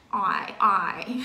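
broad Australian "oai, oai", the vowel stretched wide; Australian accent. Shown as a demonstration. Teacher mimics how Australians say I, broad Australian vowel. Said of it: "Úc thì nó hơi".

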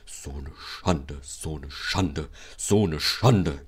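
dragged-out, labouring complaint; moaning. A narrator's reading. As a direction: wail wearily like a sulking engine. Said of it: "jammerte er".

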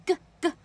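g as a short voiced "guh", no puff; g, g in short guh syllables; unaspirated sound. Shown as a demonstration. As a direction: say g twice, voiced and airless. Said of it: "把这个k变成g".

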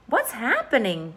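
ordinary delivery, nothing marked

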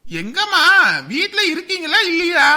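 high, loud shout across the house; husband calling for his wife, loud and impatient. Acted. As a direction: yell to find her.

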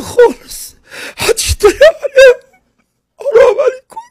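hammed-up high wail; mock crying. Mock wailing for caller.

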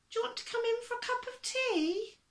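put-on very high, quick little old lady voice; coaxing old lady. A narrator's reading. Little old lady offering the zookeeper tea, high and coaxing. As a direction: invite sweetly in a high-pitched put-on voice.

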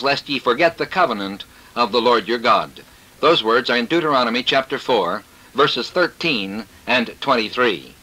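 ordinary talk, nothing marked

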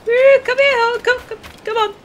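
very high, loud coaxing call; animal call. Pretend. Player calls the boat like a horse, high-pitched coaxing.

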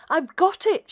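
high, loud eureka cry; the snail crying out a bright idea; cried. Acted. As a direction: shout it with sudden discovery. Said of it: "she cried".

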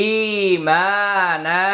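ই, মা and না each held long; slow recitation, every syllable of ই মা না drawn out; one-alif stretch. Shown as a demonstration. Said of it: "ই এক আলিফ টান".

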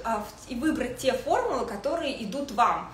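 ordinary talk

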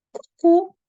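high, loud «ку-ку»; teacher modelling a friendly French greeting; playful greeting. Shown as a demonstration. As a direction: sing out «ку-ку» to a friend. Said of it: "ми можемо сказати або".

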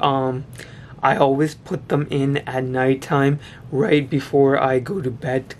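talking while fixing teeth; fingers in mouth. Real, not acted. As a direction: lip pulled back by fingers. Seen 3: Fingers pull the lip and work on the teeth; brief pause at 6.4.

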